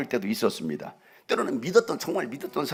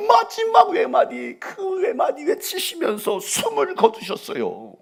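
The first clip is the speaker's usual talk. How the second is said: higher, louder, slow preacher's cry; climactic preaching; 6 dB louder, much slower than usual. Acted. Preacher telling the crucifixion, slow and crying out. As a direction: stretch it out like a climax.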